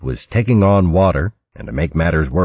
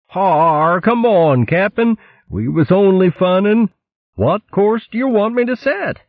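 much higher, wheedling sailor; weasel helmsman. Acted. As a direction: coax the captain back.

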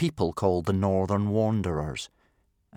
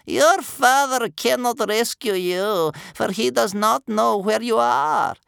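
put-on loud, raspy, scary old woman; doom-filled furious grandmother; 9 semitones higher, 6 dB louder than usual. A narrator's reading. Chained grandmother scolds captive grandson, furious rasp. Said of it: "furious voice of Bear Cub's scary grandmother".

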